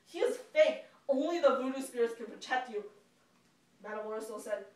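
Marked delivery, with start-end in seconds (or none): 0.0-2.9 loud, higher angry outburst; a furious husband yelling at his wife. Acted. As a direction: rage at the family's new belief. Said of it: "He was so angry".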